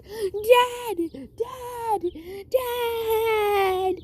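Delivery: put-on high, wailing child's cry for dad. Lost little boy calls for his dad, acted crying. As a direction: cry out high, stretching the last call. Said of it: "he started crying".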